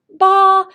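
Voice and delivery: ba held high and level. Shown as a demonstration. 高声 ba. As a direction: sing ba on a high flat pitch. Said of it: "They have a high sound".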